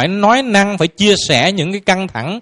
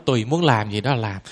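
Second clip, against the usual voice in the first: low, offhand husband brushing her off; indifferent husband; 7 semitones lower, 7 dB quieter than usual. Retold or imitated. Husband answering his wife's questions, low and indifferent. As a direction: shrug it off in a lower voice like the silent husband. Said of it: "ông nói".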